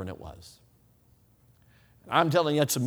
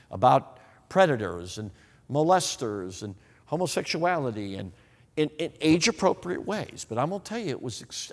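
ordinary talk, nothing marked

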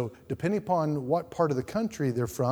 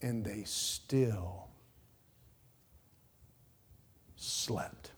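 slow, softer, fading to a whisper; dramatic pulpit hush; 5 semitones lower, 9 dB quieter, much slower than usual. Acted. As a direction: let it sink in, barely breathing slept. Sermon hush on sleeping disciples.

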